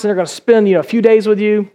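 normal talking voice

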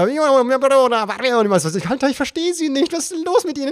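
high, frantic, near-hysterical plea; desperate pleading. Retold or imitated. Stuck at the toll barrier, pleading high and frantic. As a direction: beg for help in full panic.

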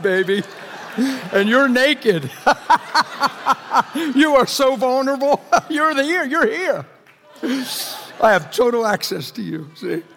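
gleeful mock menace. Preacher teasing his congregation, laughing mock menace. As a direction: cackle teasingly like a grinning predator toying with prey. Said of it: "I feel real happy and I feel smiley".

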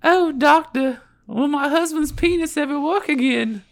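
high falsetto woman's voice; girl voice. Acted. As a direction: plead as the wife, high pitched. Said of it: "Do your girl, girl voice".

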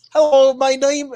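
half-hearted mock Irish, abandoned quickly; bad Irish accent. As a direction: try an Irish accent badly. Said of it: "I cannot do an Irish accent".

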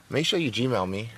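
ordinary talk, nothing marked

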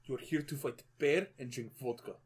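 Russian accent. Shown as a demonstration. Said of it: "that was a bad Russian".

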